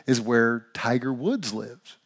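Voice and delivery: ordinary delivery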